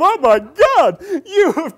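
high, loud, emotional gush; hammed-up tearful amazement; overwrought mock cry. Overwhelmed woman thanking her coach, mock tearful outburst. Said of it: "she was beginning to cry".

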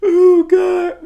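high, near-tearful gasp after laughing; worn out from laughing hard; real high, loud cry of amusement; helpless laughter. Real, not acted. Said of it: "My freaking stomach killing me".

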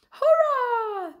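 high, loud drawn-out hurrah; cheering. A narrator's reading. Freed fish celebrate their escape, cheering. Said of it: "cheered the fish".

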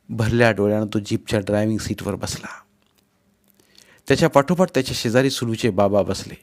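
ordinary delivery, nothing marked

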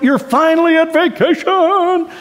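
high, slow, gushing delight; giddy excitement. Acted. Preacher to congregation, acting giddy excitement about a vacation. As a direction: squeal it like the trip has finally started.

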